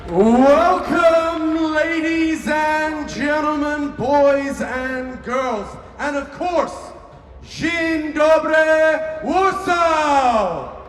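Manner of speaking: booming arena announcer, drawn out; grand entrance announcement. Real, not acted. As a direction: hype up the stadium crowd. Host opens the grand finals.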